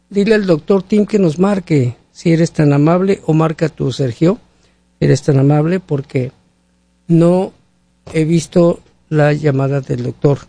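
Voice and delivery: ordinary talk, nothing marked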